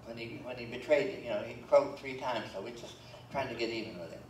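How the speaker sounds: usual speaking voice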